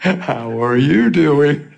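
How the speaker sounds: very slow, drawn-out how are you doing; slow and sincere, dwelling on each word. Shown as a demonstration. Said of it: "probably a little deeper than that".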